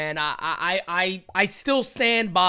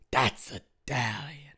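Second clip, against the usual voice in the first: hushed, whispery TikTok catchphrase mimicry; TikTok Italian guy impression; 9 semitones lower, 8 dB quieter, slower than usual. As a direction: drop low and soft, dragging the words out. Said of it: "then he'd be like".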